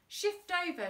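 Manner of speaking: Dad ordering the henchman to move over; shouted. A narrator's reading. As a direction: snap the order across the car. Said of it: "shouted Dad to Thumbs".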